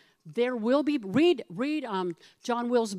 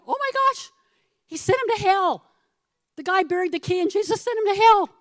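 much higher, louder mock shock; shocked gasp; 9 semitones higher, 8 dB louder than usual. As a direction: act scandalized, like the congregation gasping. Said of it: "So we're going".

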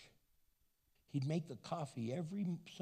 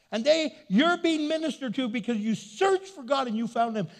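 fervent preaching; 11 semitones higher, 10 dB louder than usual. Acted. Preaching at full force.

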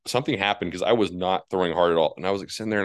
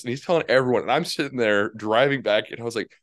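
usual speaking voice

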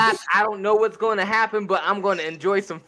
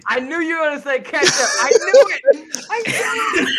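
high, loud laughing cry of triumph; excited laughing shout; 9 semitones higher, 4 dB louder than usual. Real, not acted. Friend guesses ketchup and shouts it out laughing, high and loud. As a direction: crow that you called it, laughing.

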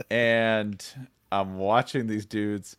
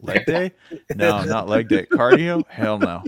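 mock gym bro; 7 dB louder than usual. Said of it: "They'll be like".

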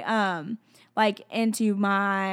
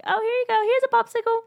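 much higher sing-song offering; God, playful giver; 13 semitones higher, faster than usual. Acted. God offering a popsicle unasked, high playful giving. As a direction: hand it over in a high, cheery voice. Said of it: "what God does sometimes. He's like".

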